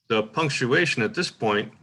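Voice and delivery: ordinary talk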